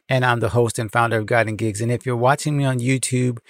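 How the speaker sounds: usual speaking voice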